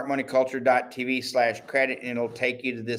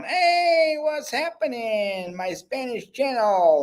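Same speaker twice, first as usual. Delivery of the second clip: hammed-up Spanish accent, pitched way up and slow; mock the viewers' complaint in an exaggerated Spanish accent; stupid Spanish accent; 11 semitones higher, slower than usual. Spanish-channel viewers upset about losing their station, in a hammed-up mock Spanish accent. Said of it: "that was a stupid accent".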